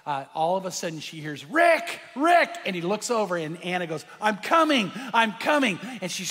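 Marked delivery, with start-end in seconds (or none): 1.4-2.6 high, loud call for attention; urgent excited hail. Retold or imitated. Calling across to Rick, retold. 4.2-5.8 loud, raised-pitch eager shout; acted excited holler; excited calling. Anna calls back excitedly, retold.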